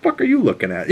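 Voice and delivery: high, laughing mock snarl at the kid; mock hostile retort. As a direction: toss it off with a laugh, playing tough. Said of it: "She's like".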